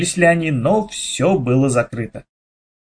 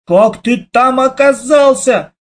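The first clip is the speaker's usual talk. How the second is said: high, slow alarmed shout; yelling into the machine; brother shouting; 9 semitones higher, 3 dB louder, slower than usual. A narrator's reading. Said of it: "Это уже я закричал". (испуганный крик брата)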